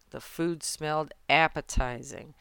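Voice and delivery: usual speaking voice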